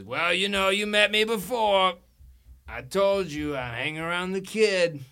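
high, loud talking cat. Acted. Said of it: "He says".

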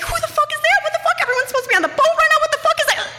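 very high, rapid mock-panicked squeal; acted panic. Creeping naked toward the doorway, high-pitched acted panic. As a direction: squeal it out in hammed-up alarm.

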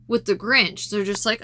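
ordinary talk, nothing marked

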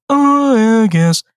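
high, drawn-out hesitant groan; reluctant agreement. Retold or imitated. Emily asked to cover a shift, mock-reluctant high whine. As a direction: imitate someone grudgingly giving in.

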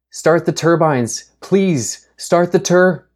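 high, loud, pleading shout; desperate shouting. Acted. Grace begging Alvis to start the engine, high loud shout. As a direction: scream it over the danger. Desperate plea as wolves attack.

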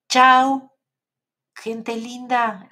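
a high, loud sung-out "Chao"; loud cheerful goodbye. Real, not acted. Host waving goodbye to her live audience, loud cheerful farewell. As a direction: call it out to the whole audience.

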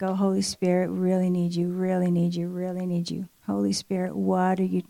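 soft, low pleading under the breath; hushed, insistent prayer; private prayer. Retold or imitated. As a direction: pray quietly, repeating the plea to yourself.

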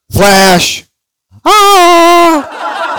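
high, loud yell out a window; imitated yell. Neighbor yells out window along with Queen soundtrack, high loud shout. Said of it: "he yells out the window".

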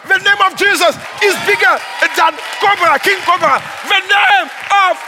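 loud, high, pounding sermon delivery; fiery preaching. Acted. Pastor driving home the power of the name, full-force preaching. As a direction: preach it hard to the congregation.

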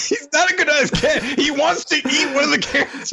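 high laughing objection; high-pitched laughing protest. Real, not acted. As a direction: laugh through the correction. Mock protest about the cat.

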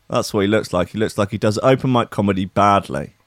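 ordinary talk, nothing marked

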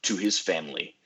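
dramatic trailer narration; movie trailer voice. Acted. Movie trailer style intro.

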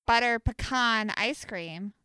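pecan as puh-KAHN in butter pecan ice cream. Shown as a demonstration. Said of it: "when you're saying".

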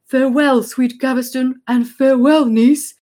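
hammed-up stage king; King Edward's farewell. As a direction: declaim a grand farewell.

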